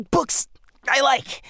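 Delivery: high, slow breathy hiss through pain. A narrator's reading. Jack hisses at Michelle, hiding his pain. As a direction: hiss it out while burning. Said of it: "he hissed".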